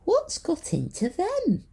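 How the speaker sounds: very high, slow worried voice; concerned. A narrator's reading. Walking Stick worried about her crewmates, high concerned voice. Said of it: "asked Walking Stick in concern".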